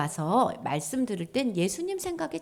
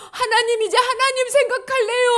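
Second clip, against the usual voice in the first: eager cry; 8 dB louder than usual. Acted. People crying out to God.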